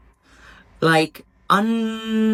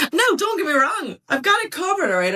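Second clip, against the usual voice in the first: high-pitched protest; 12 semitones higher, faster than usual. Real, not acted. Vlogger insisting the bills are covered, high-pitched. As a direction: voice shot way up after a laugh. Laughing reassurance after complaint.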